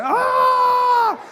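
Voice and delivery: sudden high shriek of panic; panicked scream. Retold or imitated. Driver on the wrong side screams in panic, high shriek. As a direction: yelp like a car is coming at you.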